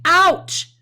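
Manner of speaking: sharp, loud yelp of pain on Ouch; pained cry. Shown as a demonstration. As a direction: cry Ouch like a bee just stung you. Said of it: "You're going to say".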